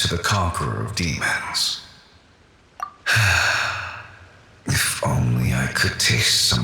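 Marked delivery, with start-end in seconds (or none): 2.8-4.0 a short falling breath of longing; wistful sigh. Acted. Pervases wishing for grilled fish, wistful sigh. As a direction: sigh for what is gone. Ghost sighs before farewell wish.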